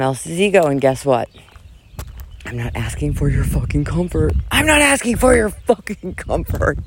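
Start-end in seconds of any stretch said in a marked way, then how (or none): 4.5-6.8 high-pitched mock yell, held back in volume; faux yell. Vlogger ranting at her audience, a faux yell. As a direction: yell it without real loudness, keeping the neighbors calm. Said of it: "faux yell".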